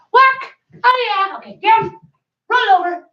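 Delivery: high, bright trick commands; dog commands. Real, not acted. Owner calls tricks to her dog, high and loud. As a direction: cue each trick up high.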